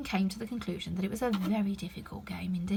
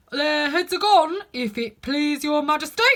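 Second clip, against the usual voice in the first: loud, higher soldier's reply; soldier shouting; 6 semitones higher, 10 dB louder than usual. Acted. Soldier shouting his report to the Queen. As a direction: shout back dutifully to the Queen. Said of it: "the soldier shouted in reply".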